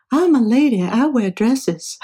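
slow, drawn-out mock Southern lady; Southern accent. Roommate refusing to align the tape machine, imitated in a Southern accent. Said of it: "with her Southern accent".

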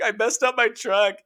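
high, whiny mock lament; self-pitying whine. Husband whines to his wife about truck and hand. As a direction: whimper like a hurt kid.

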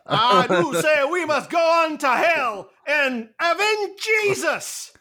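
put-on slow, high, shouting Southern preacher accent. Doomslayer as a Southern preacher rousing the flock, shouted Southern accent. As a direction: bellow it like a Southern hellfire preacher. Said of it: "hardcore Southern accent".

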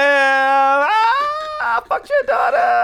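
half-crying shouted curse; mock outburst. Venting to friends with a shouted curse. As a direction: yell it out like a wounded rant. Heated rant about a rival.

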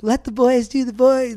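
high-pitched mock mom voice; indulgent mom. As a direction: play along, pitched well up, as an indulgent mom. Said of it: "she's playing all into it".

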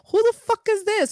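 mock outraged demand. Retelling her reply to a stranger texting her, mock outraged. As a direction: replay the indignant text as a put-on shout.

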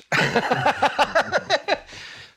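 long, high staccato laugh; hearty laugh. Real, not acted. Guitarist laughing at his own ankle mishap, high laugh. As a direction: burst into a high, rattling laugh.

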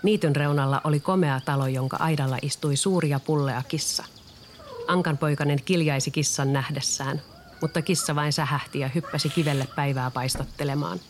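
ordinary delivery

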